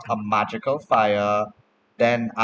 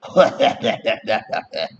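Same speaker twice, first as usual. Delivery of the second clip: loud in-character cackle; acted taunting laugh; mocking dwarf-child laugh; 5 dB louder than usual. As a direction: laugh at your own jibe as a dwarf kid. Dwarf child laughs at own jibe.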